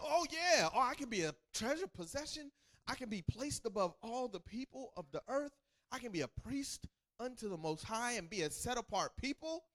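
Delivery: high, thrilled voice of the crowd accepting a deal; playing the delighted Israelites; excited people. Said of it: "They was excited right there".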